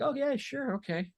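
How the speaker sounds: high, breezy fake yes; mock eager agreement. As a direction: brush off the request with a bright high yes. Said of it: "I'm gonna be like".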